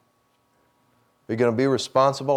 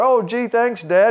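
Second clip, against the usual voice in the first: little daughter voice; 11 semitones higher, 4 dB louder, slower than usual. Retold or imitated. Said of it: "his little daughter".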